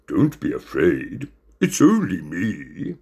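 slow, put-on reassuring voice; tapir character. A narrator's reading. Hidden tapir calms a frightened cat, slowly. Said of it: "came back the reply".

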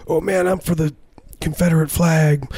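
high, drawn-up mocking voice; mock Confederate die-hard. Mocking people who cheer the Confederate flag, high mock zealot. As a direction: mimic a flag-waving Confederate fan, pitched high. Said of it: "these people who are like".

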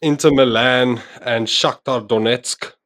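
ordinary delivery

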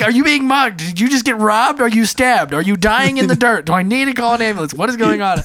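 high, rushed panicked questions; frantic questions. Retold or imitated. Husband on phone with sobbing wife, frantic. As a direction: fire off the worst-case fears.

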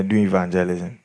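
normal talking voice

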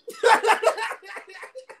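high burst of laughter; loud laugh. Real, not acted. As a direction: crack up laughing. Laughing at own joke.

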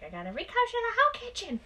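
high-pitched imitation of an upset mom; high mock-upset mother voice; exasperated mom. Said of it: "she was like".

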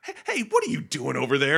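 loud, high-pitched challenge to an intruder; indignant challenge. Retold or imitated. Luke to little green guy raiding his food, indignant. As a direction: call out in mock alarm at someone raiding your camp.

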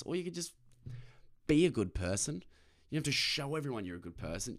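ordinary talk, nothing marked

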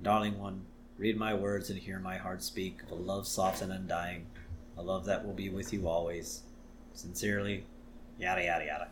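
low, soft eye-rolling drone; mock weary assistant; a deadpan Smithers sigh; bored dictation. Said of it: "Smithers rolls his eyes".

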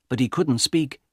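ordinary delivery

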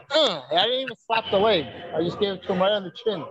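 ordinary talk

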